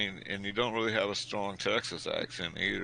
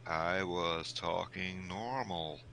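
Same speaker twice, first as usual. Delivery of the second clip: half-speed, stretched-out words; slow Texas talk; 4 dB quieter, much slower than usual. Acted. Texan version of talking normal, slowly drawn out. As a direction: say it very slowly, pitched in the usual range. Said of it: "where they talk real slow".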